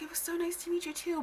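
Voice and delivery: high whispered sweetness; polite little girl voice; child's polite reply. Retold or imitated. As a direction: answer sweetly like a small girl. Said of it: "And I was like".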